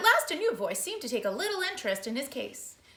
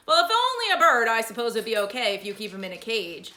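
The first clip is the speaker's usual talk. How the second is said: policeman; 8 dB louder than usual. Acted. Policeman voiced in story.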